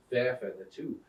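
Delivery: ordinary delivery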